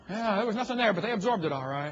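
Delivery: mocking, knowing sarcasm on "nothing there"; dry sarcasm, lightly voiced; sarcastic mock agreement. Real, not acted. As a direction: scoff at the claim with a mock nod. Mocking the textbook claim.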